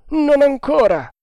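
very high, loud, drawn call; shouted reply. A narrator's reading. Lookout reporting to the captain below, shouting.

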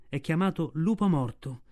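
usual speaking voice

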